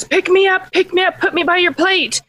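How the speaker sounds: high, insistent frog voice; demanding little frog. Acted. Frog demands to join dinner.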